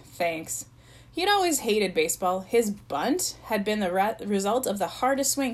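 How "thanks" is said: low, unimpressed thanks; understated and reluctant; thin smile. A narrator's reading. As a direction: thank them halfheartedly. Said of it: "smiled thinly".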